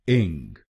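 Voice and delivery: इंग said as "ing"; syllable demo. Shown as a demonstration. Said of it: "हम बोलेंगे इंग".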